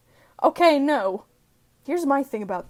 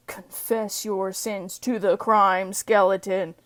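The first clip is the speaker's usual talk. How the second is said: slow, ominous skeleton voice; skeleton interrogator; 3 semitones lower, slower than usual. Acted. Skeleton demanding a confession from a suspect, slow ominous interrogator. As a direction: draw it out like a looming interrogator. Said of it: "human skeleton with glowing red eyes".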